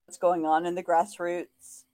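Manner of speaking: ordinary talk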